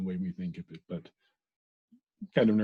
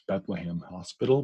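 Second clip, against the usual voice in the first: ordinary delivery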